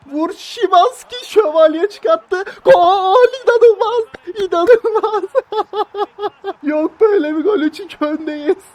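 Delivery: screaming football commentator. Acted. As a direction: scream the winner at the top of your voice. Winning goal commentary.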